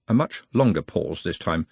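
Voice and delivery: plain reading voice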